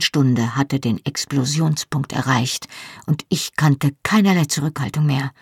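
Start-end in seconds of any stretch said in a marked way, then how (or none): none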